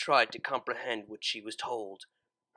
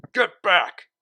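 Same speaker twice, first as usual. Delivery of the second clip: louder, higher barked order; yelling; 6 semitones higher, 10 dB louder than usual. Acted. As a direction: shout them back. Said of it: "suits yelled".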